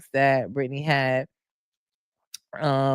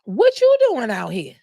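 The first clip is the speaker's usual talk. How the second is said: talking at Brandy; 9 semitones higher, 4 dB louder than usual. Retold or imitated. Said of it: "I was saying".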